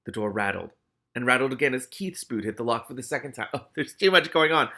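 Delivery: ordinary delivery, nothing marked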